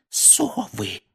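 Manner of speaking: aunt mouthing the forbidden word; mouthed. A narrator's reading. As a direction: shape Совы almost without voice. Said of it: "Гарри прочитал по губам".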